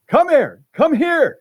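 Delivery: high loud call across the beach; yelling. Retold or imitated. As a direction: call her over urgently. Fisherman yelling to Mary.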